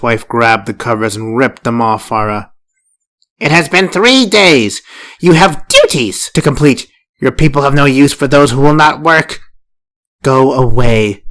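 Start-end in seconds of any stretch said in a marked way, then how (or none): 3.4-11.2 loud, high stern scolding. Acted. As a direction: berate her like a harsh elder. First wife scolding Farah.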